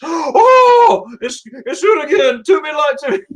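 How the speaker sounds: high, loud startled yelp; shocked apology. Retold or imitated. Teenage boys caught gossiping by an American, shocked apology. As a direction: blurt out a flustered, acted-out apology. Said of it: "they were shocked".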